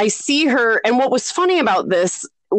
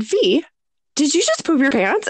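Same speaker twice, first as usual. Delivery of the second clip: joking question; 6 semitones higher than usual. Retold or imitated. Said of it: "I said it as a joke".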